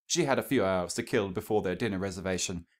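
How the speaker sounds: ordinary delivery, nothing marked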